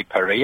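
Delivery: "Paris" with no final S; French pronunciation. Shown as a demonstration. Caller showing he can say it, "Paris" with silent S. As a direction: say "Paris" as pa-REE. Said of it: "I don't need to pronounce the S".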